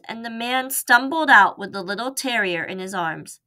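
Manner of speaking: ordinary delivery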